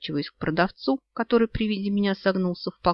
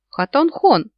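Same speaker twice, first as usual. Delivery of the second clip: calling out; 10 semitones higher, 8 dB louder, slower than usual. Acted. Someone calling out at market.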